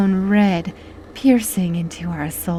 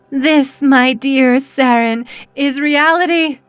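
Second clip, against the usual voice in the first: gloating; 6 semitones higher, 5 dB louder than usual. Acted. Cultist gloating at victim.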